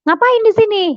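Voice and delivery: imitating a startled husband, high-pitched. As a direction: blurt it as a surprised question. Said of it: "Suami saya kaget".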